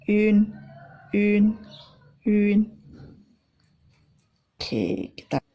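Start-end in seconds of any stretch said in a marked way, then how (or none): 0.1-2.7 "yun" as a level ü gliding into n; ü ketemu n. Shown as a demonstration. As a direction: hold "yun" level, ending on n. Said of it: "dibaca dalam huruf N". ("yun" bunyi ü lalu n)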